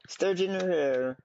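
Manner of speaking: high slow grand voice; dramatic announcer. Pretend. Dramatic reading of fish.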